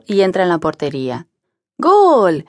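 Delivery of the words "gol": higher, louder goal yell; crowd shout. A narrator's reading. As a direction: shout it like the home fans. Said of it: "gritan los seguidores".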